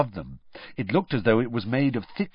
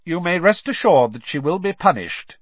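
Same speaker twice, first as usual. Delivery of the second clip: cold; 7 semitones higher, 6 dB louder than usual. A narrator's reading. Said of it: "Mister Crouch added coldly".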